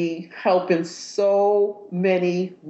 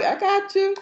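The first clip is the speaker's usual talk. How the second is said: high playful quote; 13 semitones higher than usual. Retold or imitated. Playful retort in story.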